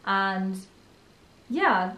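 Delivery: ordinary talk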